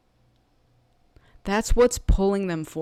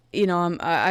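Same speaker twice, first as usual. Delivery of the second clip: ordinary talk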